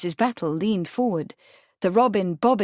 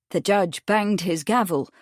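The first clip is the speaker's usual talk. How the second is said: usual speaking voice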